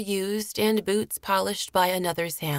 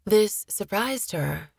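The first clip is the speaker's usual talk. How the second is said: ordinary delivery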